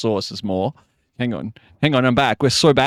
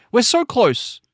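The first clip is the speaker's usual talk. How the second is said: pitched way up, eager; high excited take; 10 semitones higher, slower than usual. Acted. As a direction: fling it high like it's almost done. Repeating the same line twice.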